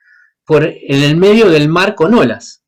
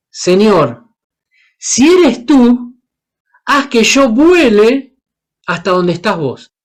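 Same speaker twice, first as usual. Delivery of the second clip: higher, very slow pleading on eres and vuele; imagined Peter's plea; 5 semitones higher, slower than usual. Retold or imitated. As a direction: stretch out a wondering, pleading ask. Pedro imaginado pidiendo volar. (súplica de Pedro, aguda y muy lenta)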